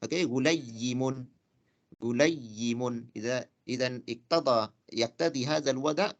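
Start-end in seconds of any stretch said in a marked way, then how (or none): 0.2-3.1 غليم drawn out very slowly, said twice; slow model word. Shown as a demonstration. Teacher modelling the diminutive for students, slow. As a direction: stretch غليم syllable by syllable. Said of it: "إذا أردنا أن نصغره على وزن فعيل".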